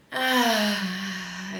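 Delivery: audible relaxed exhale on ah; sounded exhale. Shown as a demonstration. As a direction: let out a long sighing ah. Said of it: "you sound your exhales".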